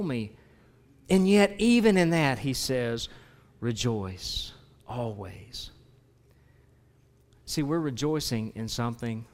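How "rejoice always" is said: slow hushed quote. Acted. Quoting Paul softly in sermon.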